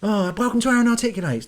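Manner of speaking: sending up his own cheesy opener; mock show intro. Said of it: "do a video like that every night".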